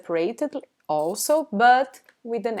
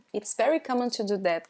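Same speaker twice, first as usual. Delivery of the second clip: normal talking voice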